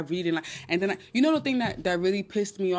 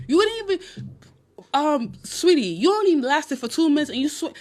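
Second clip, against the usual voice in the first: higher, louder teasing scold at a lover; sweetie, you only lasted two minutes, mock scold; 8 semitones higher, 4 dB louder than usual. Talking to a sweaty lover in bed, mock high scold.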